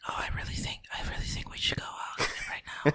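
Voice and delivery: barely audible whispered line; mock a mumbling actor, voice nearly gone; mumbled whisper. Sound man mocking a mumbling actor in a whisper.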